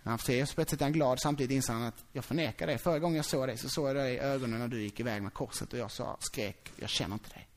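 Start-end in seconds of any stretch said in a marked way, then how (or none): 2.1-7.5 remorseful confession. Acted. Petrus' remorseful inner confession.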